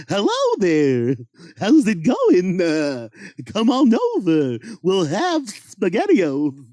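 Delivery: slow sing-song cartoon greeting; a lightly cartoony friendly clown. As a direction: invite the kids over, sweet and silly. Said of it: "that is Roger Rabbit".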